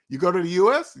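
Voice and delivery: ordinary delivery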